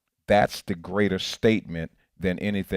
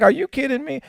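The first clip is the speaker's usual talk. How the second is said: exasperated; 8 dB louder than usual. Retold or imitated. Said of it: "It's like".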